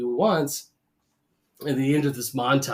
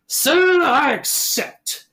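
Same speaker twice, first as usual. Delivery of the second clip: high, slow Southern gentleman drawl; 8 semitones higher, 5 dB louder, much slower than usual. Retold or imitated. Host imitating the Southern duelist accepting the duel, slow Southern drawl. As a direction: drawl it out like an old Southern colonel. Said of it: "this southern drawl".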